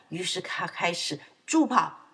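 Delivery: plain reading voice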